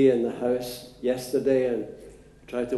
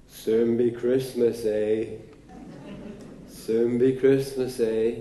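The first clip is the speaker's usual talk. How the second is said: put-on broad Manchester accent, slow; a slow, drawn-out Manchester accent; 2 semitones lower, slower than usual. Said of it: "in her broad Manchester accent".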